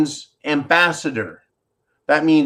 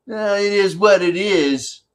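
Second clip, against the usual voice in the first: mocking Zelensky; 5 semitones higher than usual.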